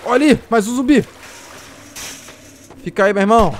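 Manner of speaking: loud, high call at the zombie; excited taunt. Real, not acted. Gamer spotting a zombie and taunting it, loud excited call. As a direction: hype yourself up and mock the enemy.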